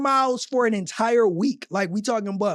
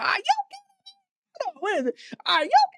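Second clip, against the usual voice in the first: high mock-outraged squeal, half laughing; imitating her disbelief; much faster than usual. Host replays shopper's outrage to viewers, high mock squeal. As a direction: squeak it out like she did. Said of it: "She was, she was like".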